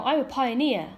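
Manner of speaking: ordinary talk, nothing marked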